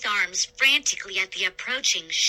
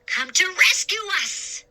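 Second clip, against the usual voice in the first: hopeful shout; 9 semitones higher than usual. A narrator's reading.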